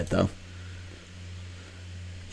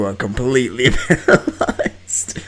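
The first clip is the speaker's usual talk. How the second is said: laughing; 3 semitones higher, 5 dB louder than usual. Real, not acted. Laughs during narration.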